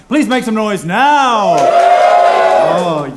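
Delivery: loud, slow crowd-hyping call; hype shout. Real, not acted. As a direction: whip up the crowd.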